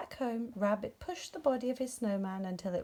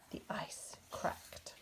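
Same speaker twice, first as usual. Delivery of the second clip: whisper; 11 dB quieter, much slower than usual. A narrator's reading. Tense moment in story.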